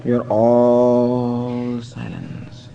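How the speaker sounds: very slow, low, grave swami voice; a lightly voiced holy man stretching each word slowly. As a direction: draw it out like a serene sage. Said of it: "more Swami like".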